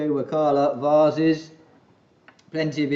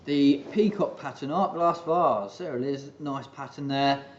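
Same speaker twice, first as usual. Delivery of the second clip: usual speaking voice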